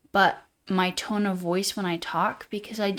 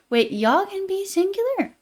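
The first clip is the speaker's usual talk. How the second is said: much higher pitch, climbing in disbelief; surprised disbelief; 11 semitones higher than usual. Acted. Streamer questioning a chat message about y'all, surprised. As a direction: react as if caught completely off guard.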